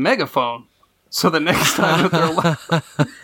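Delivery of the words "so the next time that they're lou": laughing. Real, not acted. Laughing about his megaphone.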